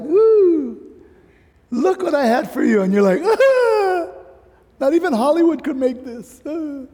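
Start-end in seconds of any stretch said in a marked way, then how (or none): 0.0-2.8 higher and louder, a joyful reveal; delighted welcome. Acted. God greeting a believer arriving in heaven, delighted. 3.2-6.8 a much higher gasp with a laugh, then amazed; awestruck laughing exclamation. Acted. Believer seeing heaven for the first time, awestruck and laughing.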